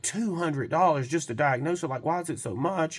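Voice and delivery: imitating a customer's stunned objection; incredulous. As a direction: balk at the cost in disbelief. Customer balks at the price.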